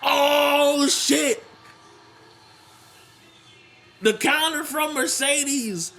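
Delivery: high, drawn-out excited call of the finish; wrestling commentator at a shock finish; excited play-by-play. Real, not acted. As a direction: stretch the words out, pitched high and thrilled. Live match finish, big reaction.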